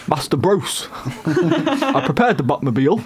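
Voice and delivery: hammed-up butler impression, rising high and slow; lightly voiced butler with laughter creeping in; Alfred. Host trying another impression for co-hosts, drawn-out butler voice. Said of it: "The Dark Knight".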